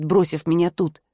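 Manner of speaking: plain reading voice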